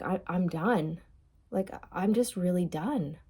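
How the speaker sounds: quiet, resigned mock quote; softly final, drained of fight; detached, done. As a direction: imitate someone saddened but completely done. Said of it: "Kind of detached".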